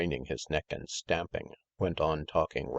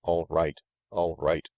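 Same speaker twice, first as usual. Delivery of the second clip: yawning; 5 dB louder than usual. A narrator's reading. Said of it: "said his master, yawning".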